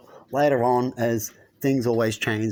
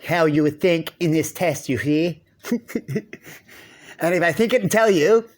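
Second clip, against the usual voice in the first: lightly voiced inner twin, higher and louder; the other twin; 5 semitones higher, 5 dB louder than usual. Voicing the inner twin who challenges you, lightly acted. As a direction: play the mischievous other voice in your head, only a hint of a character. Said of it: "he's the one that goes".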